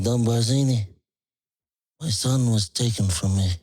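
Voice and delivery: mumbled mafia don lament; Marlon Brando impression. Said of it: "Marlon Brando, The Godfather".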